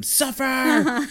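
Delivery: high, loud creature screech; mock scream. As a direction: screech it like a tormenting locust. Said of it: "the thing's just screaming at him".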